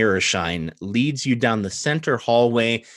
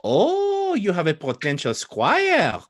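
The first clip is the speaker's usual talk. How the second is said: put-on French accent; 3 semitones higher than usual. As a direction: exclaim with delight in a French accent. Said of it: "French in this case would be Old Adari".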